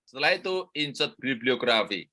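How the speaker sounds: usual speaking voice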